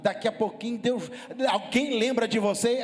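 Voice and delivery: rising sermon fervour. Acted. Pastor preaching to the congregation, swelling to a loud climax. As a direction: build it up to a full preach. Preacher building to full force.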